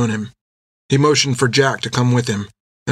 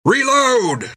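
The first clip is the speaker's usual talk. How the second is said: very high barked command; shout; 13 semitones higher than usual. A narrator's reading. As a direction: snap the order to the gun crew. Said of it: "and Jack shouted".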